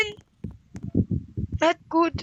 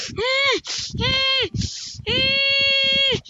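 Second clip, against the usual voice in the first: strained hum; 9 semitones higher, much slower than usual. Acted. Straining sound.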